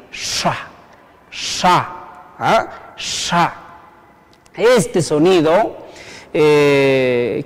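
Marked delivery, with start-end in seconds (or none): 0.1-3.5 "sha" with the SH curled back, softly, on its own; retroflex SH. Shown as a demonstration. Teacher showing learners the apostrophe sound, retroflex SH. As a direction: say "sha" with the tongue tip curled toward the palate. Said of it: "S H con un apóstrofo suena así".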